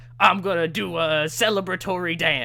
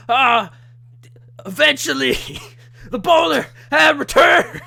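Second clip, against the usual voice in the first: high, loud, laughing mock announcement; mock wrestler shouting his big comeback; hammed-up wrestler promo; 10 semitones higher, 10 dB louder than usual. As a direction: boom it out like a ring return.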